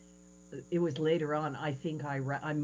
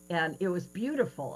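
ordinary talk, nothing marked

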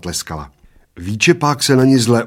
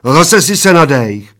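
loud, higher exasperated complaint; disgusted; 5 semitones higher, 8 dB louder than usual. A narrator's reading. Bald man catches him breathing, disgusted. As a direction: accuse with fed-up disgust. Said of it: "řekl plešatec znechuceně".

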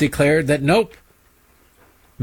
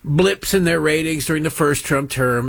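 ordinary delivery

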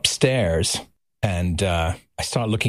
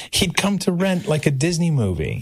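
normal talking voice

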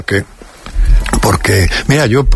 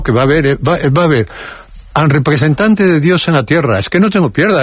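ordinary talk, nothing marked

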